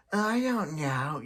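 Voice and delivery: slow, dopey toy-character drawl, lightly done; a quick playful take on Forky's dazed voice. Retold or imitated. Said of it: "that's what he would say if he was still the Forky character".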